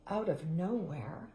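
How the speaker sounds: ordinary delivery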